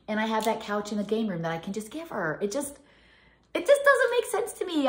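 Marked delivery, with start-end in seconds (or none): 3.5-4.9 emphatic. Real, not acted. Exasperated about giving furniture.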